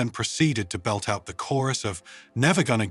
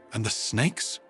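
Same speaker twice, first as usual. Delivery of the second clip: mildly nervous question with rising end; hint of nervousness; slower than usual. A narrator's reading. Diplomat asking Sarah about snakes, faintly nervous. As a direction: lift the end, faintly uneasy. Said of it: "a hint of nervousness in its voice".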